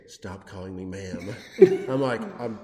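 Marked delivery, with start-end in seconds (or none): 0.1-1.3 low, slow and hushed rebuke; retold stern warning. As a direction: imitate a dealer's quiet, measured warning. Retold dealer sternly correcting him.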